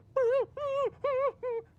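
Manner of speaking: high squealing yelp. Retold or imitated. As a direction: squeal like a scared little dog. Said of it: "the Chihuahua was like".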